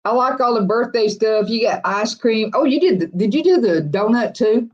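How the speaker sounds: ordinary delivery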